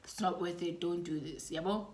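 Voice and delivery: hushed brotherly warning; imagined whisper. Retold or imitated. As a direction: talk her down quietly. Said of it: "your brother's whispering in your ear".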